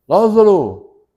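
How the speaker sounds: loud high call of the name; shouted name. Retold or imitated. Jesus at the tomb calling Lázaro, shouting. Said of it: "Ele só gritou assim".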